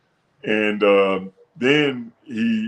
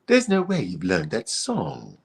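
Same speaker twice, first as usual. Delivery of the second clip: doubtful judge; 4 semitones lower, 5 dB quieter, slower than usual. Retold or imitated.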